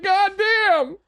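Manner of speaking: very high, stunned exclamation; acted-out amazed curse; retold astonishment. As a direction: let the pitch leap up in disbelief.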